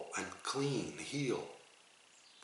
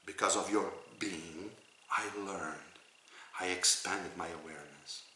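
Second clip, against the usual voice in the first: soft prayer; 2 semitones lower, 7 dB quieter than usual. Shown as a demonstration. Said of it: "So you say".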